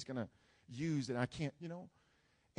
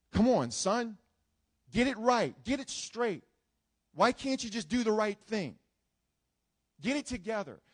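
scolding authority; 5 semitones higher, 6 dB louder than usual. Retold or imitated. Scolding authority figure voiced.

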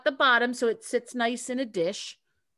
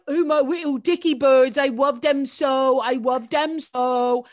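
put-on higher, louder, slow lisping old English lady; lisping dotty bird lady; 4 semitones higher, 7 dB louder, slower than usual. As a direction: coo over your dicky birds with a lisp, drawn out. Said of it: "She had a terrible accent with, like, a lisp".

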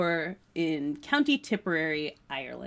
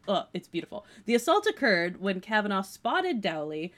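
ordinary talk

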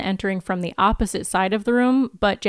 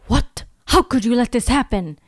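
put-on an accented What, pitched up; an accusing question in a mild Russian accent; 3 semitones higher, 3 dB louder than usual. The villain scolds Jenny, mild Russian accent. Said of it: "hear me try to do an accent".

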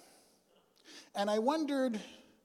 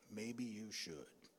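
deep tough guy voice; 7 semitones lower, 10 dB quieter, much slower than usual. Retold or imitated. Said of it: "a different deep voice said".

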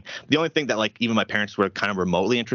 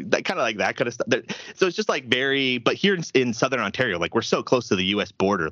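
usual speaking voice

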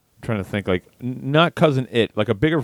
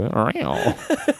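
playful monster roar; 5 semitones higher than usual. Pretend. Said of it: "best monster".

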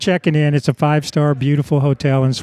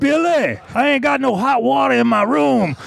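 high-pitched mock hollering; put-on high voice, calling out without full volume; imitated scream; 9 semitones higher than usual. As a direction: imitate a man hollering his complaint across a lobby. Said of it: "He screams across the lobby".

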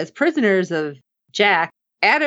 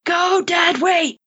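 high, slow, drawn-out yell; coaching the screen, urging a hostage to go limp; 10 semitones higher, slower than usual. Retold or imitated. Fan yelling at the TV, high and slow. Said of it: "He's yelling".

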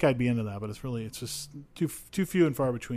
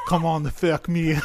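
put-on loud, higher, French-sounding stab at a Russian accent; bad Russian accent; 6 semitones higher, 9 dB louder than usual. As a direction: laugh through a botched Russian accent. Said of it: "Does she do it in a Russian accent?".

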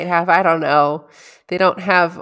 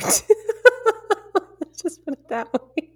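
laughing; 10 semitones higher, much slower than usual. Real, not acted. Laughing over her hurt pride.

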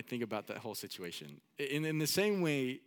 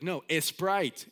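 put-on slow, higher, insistent Mexican-accented correction; louder mock Mexican accent, E before "Sprite"; grandpa's Mexican accent; 5 semitones higher, 6 dB louder, slower than usual. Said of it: "he would add an E at the beginning of everything".

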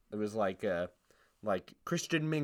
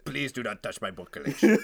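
put-on oddball voice with laughter; comic possessive character; stupid funny character. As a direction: plead as a silly book collector, close to cracking up. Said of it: "it's a stupid fucking funny character".

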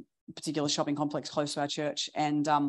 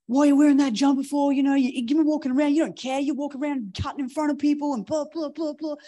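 higher, louder mock rant at the pastor; retold tirade; 10 semitones higher, 5 dB louder than usual. Stranger berating the pastor over his jumper, angry ranting. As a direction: fire it off like an irate stranger. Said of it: "he then went into a tirade".

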